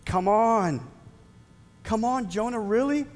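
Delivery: high, incredulous come on, really; rising exasperated really; exasperated disbelief. Real, not acted. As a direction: plead with Jonah in disbelief. Exasperated at Jonah.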